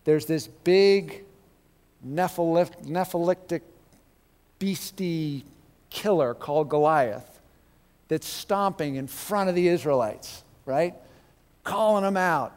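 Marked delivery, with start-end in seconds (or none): none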